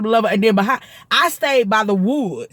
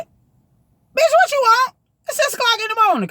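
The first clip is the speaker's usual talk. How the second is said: much higher, far-off mock grumpy voice; annoyed sleepy self. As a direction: snap at the early caller in a put-on voice. Woken up at six by call.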